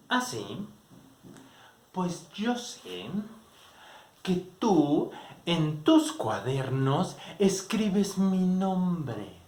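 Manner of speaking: slow, deliberate threatening tone; menacing reveal. Retold or imitated. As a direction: reveal the secret slowly, to corner him. (revelación lenta y amenazante)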